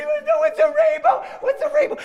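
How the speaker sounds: very high wailed words; acted little-kid meltdown; sobbing toddler. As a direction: bawl it in a squeaky child's wail. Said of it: "now she's sobbing".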